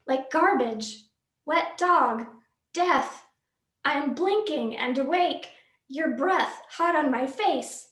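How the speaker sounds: usual speaking voice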